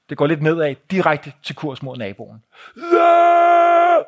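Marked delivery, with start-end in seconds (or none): 2.8-4.0 sustained high, loud roar; imitating a charging man's yell; long battle scream. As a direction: scream it out in one long held cry. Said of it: "råber han bare".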